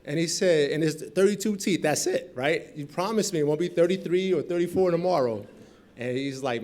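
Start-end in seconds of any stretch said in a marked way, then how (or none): 0.0-5.5 loud, high eager voice; excited George. Retold or imitated. Friend George, eager about dentistry, loud and high. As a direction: George fired up, pleading for a promise.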